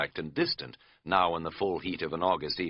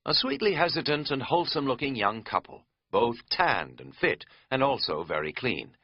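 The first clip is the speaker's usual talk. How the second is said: usual speaking voice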